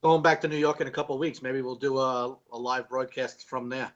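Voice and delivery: ordinary talk, nothing marked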